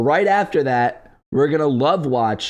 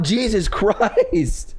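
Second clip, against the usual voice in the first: laughing disbelief; 7 semitones higher, much slower than usual. Real, not acted.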